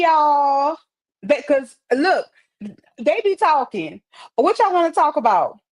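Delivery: ordinary talk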